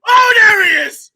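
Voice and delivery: loud, high, drawn-out cheer; finally hearing the name he wanted; excited shout. Real, not acted. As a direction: shout it out in triumph, laughing.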